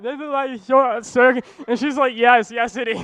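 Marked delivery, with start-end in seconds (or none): 0.0-1.4 high, mushy swollen-mouth speech; can barely move the lips. Retold or imitated. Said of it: "it comes out". 2.2-3.0 giggling yes yes; laughing agreement. Retold or imitated.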